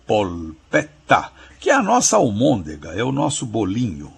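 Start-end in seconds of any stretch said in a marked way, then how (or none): 0.1-1.3 polpetta slowly, clear L and long T; Italian double T. Shown as a demonstration. Professor shows how the spelling sounds, Italian double T. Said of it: "L no meio e dois T's".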